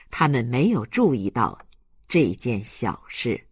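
usual speaking voice

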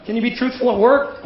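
ordinary talk

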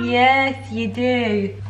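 high cooing pet voice; playful high doggy talk. Real, not acted. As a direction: coo the answer to the dog. Baby talk to dog.

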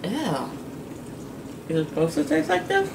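talking while chewing; grimacing with food in mouth; mouth full, chewing. Real, not acted. Seen 0: Blue-shirt speaker bites food at -2, grimaces chewing during words.

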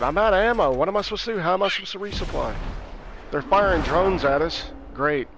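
usual speaking voice

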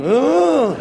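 hammed-up collective gasp; mock awe. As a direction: imitate a family reacting to grave news. Child copies family's reaction.

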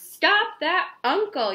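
high, loud, drawn-out call; yelling. Acted. Rosie chasing the floating uncle, yelling for help. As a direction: yell it across the yard. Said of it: "yelled Rosie".

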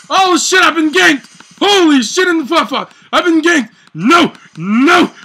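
loud, high shouts of alarm; alarmed yelling. Real, not acted. Streamer yelling as he gets ganked, loud alarm. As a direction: caught off guard, yelling out.

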